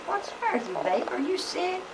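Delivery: put-on high, soft worried question to a child; worried mother. Mother gently asks her shaking little boy, high and soft.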